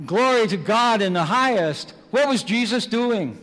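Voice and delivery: loud, high, soaring preacher's voice; preaching at full force. Acted. Preacher telling his flock of the palms, ringing out loud. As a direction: lift the hall with the story, loud and ringing. Preaching the triumphal entry loudly.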